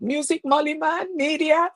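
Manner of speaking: high, sustained shout-out; high, held shout-out to the fans; excited shout-out. Real, not acted. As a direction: call out to the fans.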